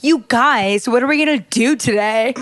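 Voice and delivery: hammed-up British family accent; mock a chirpy British accent. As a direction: pretend British houseguest impression. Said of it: "a British family's house".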